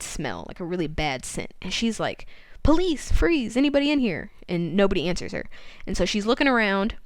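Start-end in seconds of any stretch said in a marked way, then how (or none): 2.6-4.3 imitated police challenge, pitched up; calling out. Susan calls into the barn as police, mock call-out. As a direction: call it out like an officer entering.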